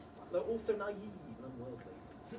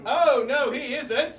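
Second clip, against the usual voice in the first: panto crowd callback; 4 semitones higher, 12 dB louder, much slower than usual. Acted. Panto call and response.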